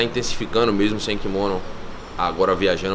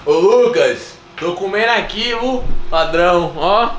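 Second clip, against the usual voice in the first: excited call; 9 semitones higher than usual. Real, not acted. Playful call to the nutritionist.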